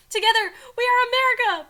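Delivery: high, loud mock-heroic line; rousing declaration. A narrator's reading. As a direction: proclaim it to a crowd. Reader voices Biden's rousing declaration.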